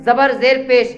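normal talking voice

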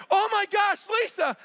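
high, loud shocked gasp; shocked cry. Retold or imitated. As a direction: cry out in horror. Shocked at crushed finger.

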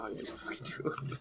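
sarcastic whisper. Real, not acted. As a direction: breathe it out teasingly. Teasing reply about headshots.

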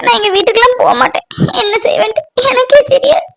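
high, tearful lament, voice breaking; weeping. Acted. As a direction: sob out her hopelessness. Girl weeping over her fate. (அழுதுகொண்டே புலம்புதல்)

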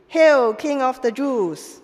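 slow, loud mock salute; mocking hail. Soldiers mocking the prisoner, slow and loud. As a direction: hail him with heavy scorn.

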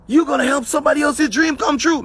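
real rush of excitement; too excited. Real, not acted. Motivational speaker getting carried away with his audience, excited. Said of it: "Man, I'm getting too excited right now".

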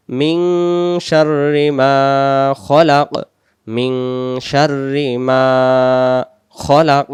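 measured recitation with held nasal and long vowel; Quran recitation. Shown as a demonstration. Teacher reciting the second verse for learners, measured recitation. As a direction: recite slowly, lingering on the held sounds.

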